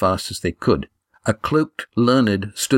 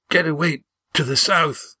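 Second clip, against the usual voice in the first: higher, strained dying voice; struggling for breath; 7 semitones higher than usual. A narrator's reading. Wounded Gar urging friends to flee, struggling for breath. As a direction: force the words out through failing breath. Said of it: "his breath bubbling in his throat as he tried to talk".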